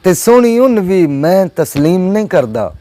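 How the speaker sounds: emphatic Punjabi verse with drawn-out key words; poetry recital. Acted. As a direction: declaim it to a mushaira crowd.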